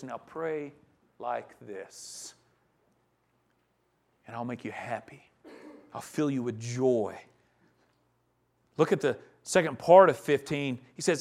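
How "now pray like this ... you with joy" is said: soft, low voice of a merciful God; tender God voice. Acted. God promising joy to his dying people, soft and tender. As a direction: hush it like a gentle promise.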